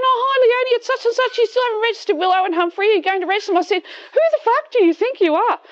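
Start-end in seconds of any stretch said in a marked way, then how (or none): 0.0-3.6 mock her falsely friendly phone voice; mimicking the council lady. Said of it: "and she's going". 3.8-5.6 furious retort. Retold or imitated. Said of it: "I just lost my shit".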